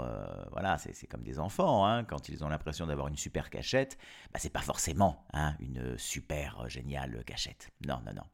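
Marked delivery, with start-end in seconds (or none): none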